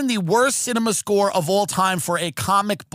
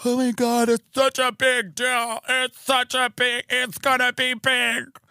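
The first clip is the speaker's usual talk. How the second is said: gushing hype; 6 semitones higher, slower than usual. Retold or imitated. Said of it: "everyone's like".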